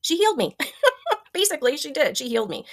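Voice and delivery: a choked laugh as the tears come; teary-eyed. Real, not acted. Telling viewers how her friend healed her, teary-eyed and laughing. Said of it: "I'm gonna start getting teary-eyed here".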